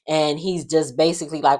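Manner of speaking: ordinary talk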